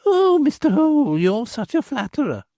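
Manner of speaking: high, coy protest; coy woman. Acted. Woman answering Hoole's flattery, coy and high. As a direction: bat it away, pleased. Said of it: "said the woman".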